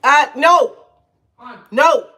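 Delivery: loud, high shout at the dog; sharp real command. Real, not acted. Speaker to someone nearby about the dog, loud commanding shout. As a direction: call out firmly to stop an interruption. Scolding a dog that interrupts her.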